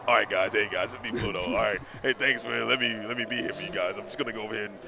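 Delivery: casual low guy voice, shrugging it off; Pluto voice. Retold or imitated. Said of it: "I bet Pluto like".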